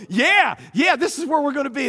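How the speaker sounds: spies hyping the land; lightly voiced eager spies; mock enthusiasm. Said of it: "they're going".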